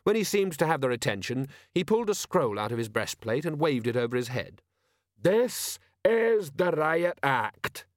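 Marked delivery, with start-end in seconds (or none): 5.2-7.9 slow, heavy troll voice pitched higher; troll sergeant announcing to the crowd. A narrator's reading. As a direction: spell it out slowly like a dim troll. Troll Detritus voice.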